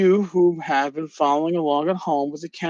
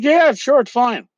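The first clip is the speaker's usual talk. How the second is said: much higher and louder, airy sure-why-not voice; breezy mock agreement; 9 semitones higher, 5 dB louder than usual. Host voicing Louis agreeing to the reading, high breezy mock voice. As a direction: toss it off like it's no big deal. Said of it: "Louis was like".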